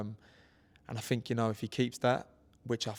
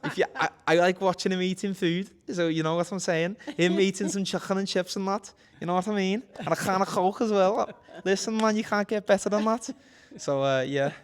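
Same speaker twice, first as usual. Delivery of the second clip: put-on much higher and louder Scouse accent with a bit of laughter; 9 semitones higher, 11 dB louder than usual. Boxer does his Scouse accent at the interviewer's request, higher and louder. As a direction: play up the Liverpool accent, cheeky and loud. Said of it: "You do your Scouse accent now if you want".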